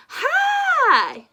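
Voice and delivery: high, loud teacher call from the sky; a big booming hello from above; Madam Dragon calling out. Acted. Teacher calling to her class high above. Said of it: "said Madam Dragon".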